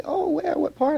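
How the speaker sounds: high mock questioning voice; imitating surprised people. Guest imitates people asking where in the South. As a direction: squeak it like a puzzled stranger. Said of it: "And they say".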